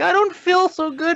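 very high, strained whimper; high dying voice. Retold or imitated. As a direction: squeak it out like fading away. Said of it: "potential Billy Juniors are like".

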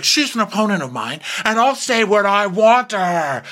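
high, petulant comeback; mock defiant retort. Acted quarrel, defiant retort.

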